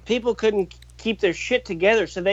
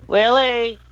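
raised voice calling a dog; 5 semitones higher, 3 dB louder than usual. Real, not acted. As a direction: call out sharply to the dog.

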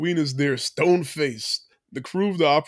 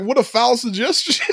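high mock offense; 8 semitones higher, 4 dB louder than usual. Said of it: "And he's like".

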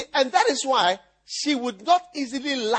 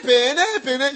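usual speaking voice

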